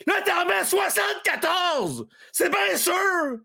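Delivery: high, slow, outraged shout. Real, not acted. Fuming at his co-hosts over seventy-four players, outraged shout. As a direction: bellow it in disbelief.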